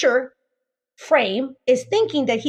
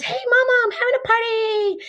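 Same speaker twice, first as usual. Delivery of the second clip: put-on girlfriend inviting; 10 semitones higher than usual. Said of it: "your girlfriend says".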